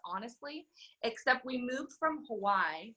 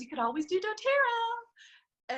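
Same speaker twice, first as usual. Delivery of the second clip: high, slow, sing-song offer to a friend; upline's suggestion; 13 semitones higher, 5 dB louder, slower than usual. Retold or imitated. Upline casually suggesting doTERRA to the newcomer, high and slow. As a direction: float the idea sweetly and slowly.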